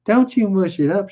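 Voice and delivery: Curley's wife voice. Acted.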